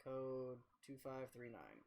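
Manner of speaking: Code two five three nine read out slowly and softly, digit by digit; slow clear readout. Shown as a demonstration. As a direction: spell out the code slowly for chat. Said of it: "Yep, I almost forgot".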